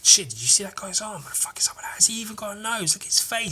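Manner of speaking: high, half-whispered mock gossip; murmuring crowd. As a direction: play onlookers whispering in disbelief.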